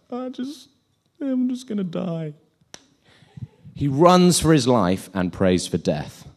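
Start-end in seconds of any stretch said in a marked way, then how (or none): 0.1-2.3 high, feeble self-pitying moan; mock whining. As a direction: give up with a hammed-up whimper. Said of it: "and whines".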